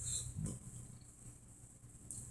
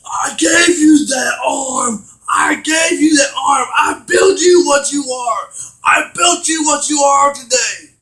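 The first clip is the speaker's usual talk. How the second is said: acted outburst; 6 semitones higher, 4 dB louder than usual. Game villain reminding the hero who built him, acted indignant shout. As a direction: hammer each phrase as an indignant creator, play-acted.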